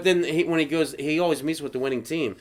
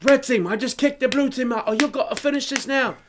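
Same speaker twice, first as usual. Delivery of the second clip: high, strained chef voice; mock kitchen commander; imitated angry chef; 9 semitones higher than usual. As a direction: order the team about, voice pitched up. Imitating chef's orders.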